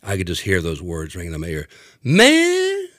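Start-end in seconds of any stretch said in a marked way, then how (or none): 2.0-2.9 much higher, louder drawn-out call; drunk buddy impression. Shown as a demonstration. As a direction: show how the drunk friend sounded. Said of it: "you got to see me when I'm doing this".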